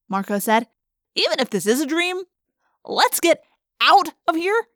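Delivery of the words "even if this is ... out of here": high-voiced, insistent teenage boy; urgent wisecracking boy. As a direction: pitch it up, stretching the last words. Marco urging escape from dream.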